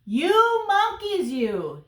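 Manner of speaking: high, loud, drawn-out scolding; scolding peddler. Acted. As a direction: scold naughty monkeys in a big story voice. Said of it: "he spoke to them".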